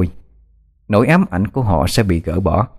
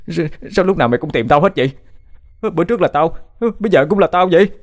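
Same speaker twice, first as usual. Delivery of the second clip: much higher, fast, desperate whining; panicked; 8 semitones higher, faster than usual. A narrator's reading. Bích asks the demon why always him, panicked. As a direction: plead in rising panic.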